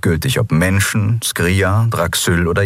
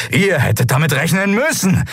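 angry reproach landing hard on "müssen"; stress on müssen; 11 semitones higher than usual. A narrator's reading. Bearded man hardens his accusation, angrily stressing "müssen". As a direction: punch "müssen" in a high, accusing cry.

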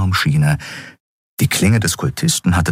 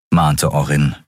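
usual speaking voice

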